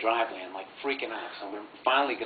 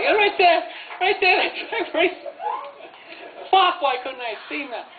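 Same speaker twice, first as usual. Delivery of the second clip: much higher, loud laughing glee; cackling at the footprints; 6 dB louder than usual. Retold or imitated. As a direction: point and howl at the spot he fell. Brothers laughing at dad's fall.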